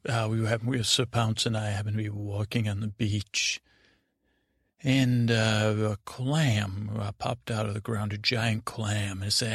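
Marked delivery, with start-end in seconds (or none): none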